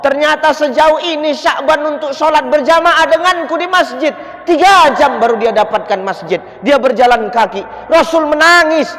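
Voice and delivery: high, loud storytelling sermon; preacher telling the faithful the story, fired up; fervent preaching. Acted. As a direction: preach it out with passion. Preacher tells story at full force.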